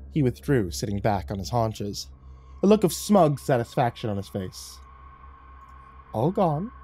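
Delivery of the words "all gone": high, teasing lilt with rising end; a playful little taunt, pitched up; tittering. A narrator's reading. Cat teasing the man after licking his hand, tittering. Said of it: "he tittered".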